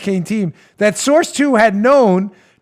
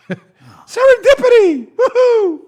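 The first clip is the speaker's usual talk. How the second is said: much higher, slow, hammed-up whoop; mock celebration; 12 semitones higher, 4 dB louder, slower than usual. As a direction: cheer like a gleeful fool. Mocking FBI's lucky find.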